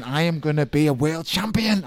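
loud, high mock victory yell; retold scream. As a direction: re-enact a triumphant boast at full voice. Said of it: "I was screaming these obscenities".

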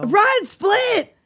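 high, full-voiced yell; shouted warning. She breaks the window, shouts to flee. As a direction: yell it to send a friend running.

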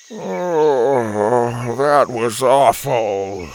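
put-on slow, pained groan of a dizzy old man. A narrator's reading. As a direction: drag it out like you're sick. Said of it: "he groaned".

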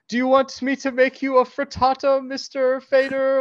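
high, loud sing-song nanny offer; mock nanny voice. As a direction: sweetly offer like a doting nanny. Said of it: "she goes like".

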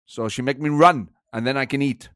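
put-on German football manager; comic German manager voice; Thomas Frank. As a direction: grumble in a German-accented impression. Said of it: "It's a good impression".

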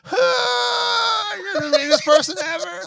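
high, loud wailing tantrum; mock crying. Upset teen to dad over a simple question, mock crying. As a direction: bawl it out in hammed-up outrage. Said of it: "like, crying".

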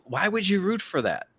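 ordinary talk, nothing marked

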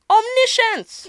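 one word sung out high; high-pitched punchline. Acted. Capping God's "I remember" line, high-pitched punchline. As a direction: leap way up in pitch.

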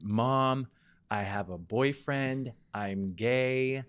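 mildly snapping back at his mom; flipped out. Retold or imitated. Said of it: "I kind of flipped out".

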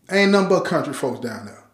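ordinary delivery

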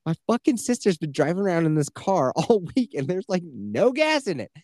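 hammed-up annoyance, climbing up at the end; frustrated cursing. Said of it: "and I was like".

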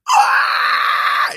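piercing high scream. Retold or imitated. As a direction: shriek at the top of your lungs. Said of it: "just goes".

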